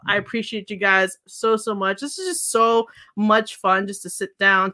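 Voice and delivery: ordinary talk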